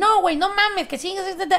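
high, excited Mexican slang burst; excited disbelief. Retold or imitated. Mexican girl in London reacting, excited and high. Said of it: "She was like".

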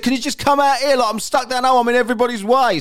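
high, loud exasperated plea; struggling colleague. Retold or imitated. Coworker stuck down a hole calling for help, exasperated retold plea. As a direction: plead as the stuck workmate, pitch right up. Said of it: "he's really in pain".

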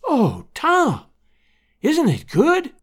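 high, swooping joyful wonder; overjoyed old aunt, voice lifted high; overcome with happiness. A narrator's reading. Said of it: "tears of happiness streaming down".

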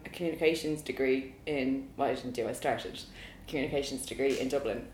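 ordinary delivery

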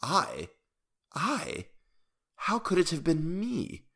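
high, soft, halting protest; quietly astonished dwarf. A narrator's reading. Dwarf denying the noise to the king, soft high surprise. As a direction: draw out each wondering, innocent question. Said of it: "looking fixedly but quietly into the tyrant's face, merely ejaculated".

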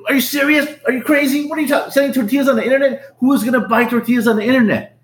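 half-yelling at his brother in shocked disbelief; incredulous mock yell. To his brother about selling online, an incredulous high mock yell. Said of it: "like, yell at him".

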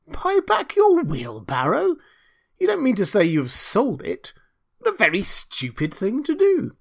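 incredulous, high swooping pompous voice; aghast miller. Acted. Miller scolding Hans for selling the wheelbarrow, aghast. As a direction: draw it out in scandalised disbelief.

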